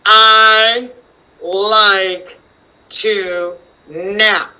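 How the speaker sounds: put-on slow, separated words for young readers; word-by-word reading. Shown as a demonstration. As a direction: read each word slowly, leaving gaps.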